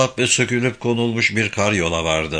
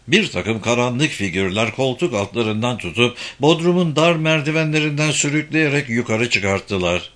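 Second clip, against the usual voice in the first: ordinary delivery, nothing marked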